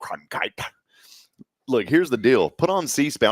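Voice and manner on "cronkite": hushed, low newsman impression; whispery mock anchorman sign-off; Walter Cronkite. Imitates newsman after co-host names him.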